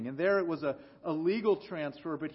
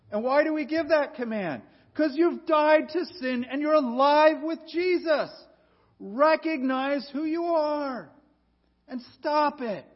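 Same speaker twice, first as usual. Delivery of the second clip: louder, higher pulpit command; preaching at full force; 9 semitones higher, 7 dB louder than usual. Acted. Pastor urging his church to stop sinning, forceful preaching. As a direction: drive the point home to the congregation.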